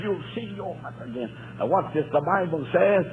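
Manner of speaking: ordinary talk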